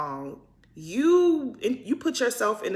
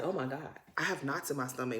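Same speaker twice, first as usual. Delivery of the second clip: imitating nervous Mary; 6 semitones lower, 11 dB quieter, faster than usual. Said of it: "Mary is just like".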